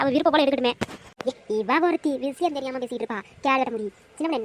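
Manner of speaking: ordinary delivery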